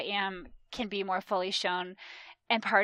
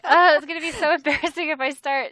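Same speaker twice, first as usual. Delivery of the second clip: laughing embarrassment; 6 semitones higher, 12 dB louder than usual. Retold or imitated. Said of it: "was like".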